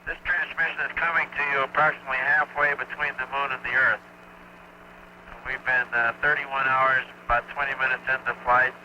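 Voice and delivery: distant radio broadcast voice; space broadcast. Real, not acted. TV transmission from spacecraft.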